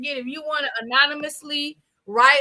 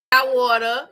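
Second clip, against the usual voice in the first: ordinary delivery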